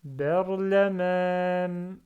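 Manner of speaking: Berlaman read slowly with its long vowel drawn out; drawn-out Arabic madd in Berlaman; prolonged vowel. Shown as a demonstration. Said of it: "Je prolonge".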